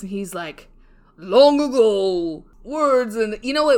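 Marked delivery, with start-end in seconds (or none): 1.2-3.1 loud, slow, booming storyteller imitation; strong-voiced narrator. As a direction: announce it grandly, drawing out the words. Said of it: "he's got a strong voice".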